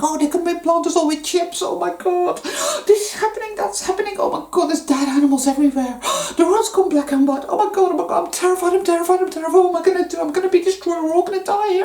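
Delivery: high, gasping, hammed-up terror; mock panic. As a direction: squeal it like someone losing it over the news. Mocking a panicked clickbait reader.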